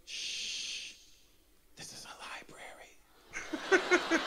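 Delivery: long shush into a slow stage whisper; slow, hissed mock-librarian whisper. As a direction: imitate a child hushing a parent. Daughter shushes dad, library.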